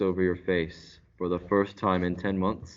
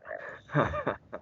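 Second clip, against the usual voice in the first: laugh; 5 dB quieter than usual. Acted.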